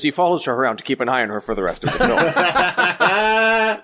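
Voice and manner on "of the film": words dissolving into laughter; giggling out the last words; laughing. Real, not acted. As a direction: laugh through the end of the line, slowly and high. Laughing at his own point.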